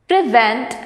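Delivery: "prevent" with the weight on VENT; stress on second syllable. Shown as a demonstration. Teacher reading words to students, "prevent" stressed on VENT. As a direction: say "prevent" as pre-VENT, rising at the end. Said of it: "stress on the second syllable".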